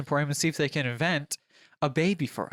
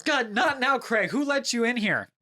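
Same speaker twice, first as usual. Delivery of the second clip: high-pitched acted annoyance; exasperated; 8 semitones higher than usual. Doctor startled by Craig barging in, exasperated. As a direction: complain high at the intruder.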